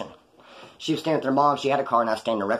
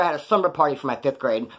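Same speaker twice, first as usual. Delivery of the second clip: usual speaking voice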